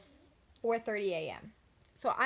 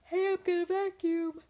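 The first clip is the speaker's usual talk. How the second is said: loud announcement; 8 semitones higher, 6 dB louder than usual. Retold or imitated. Telling sleeping sister.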